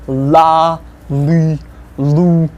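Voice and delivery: la, li, lu with a thick, hard l. Shown as a demonstration. Teacher modelling the hard L syllables. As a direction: say la, li, lu with a dark l. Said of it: "L can sound both hard".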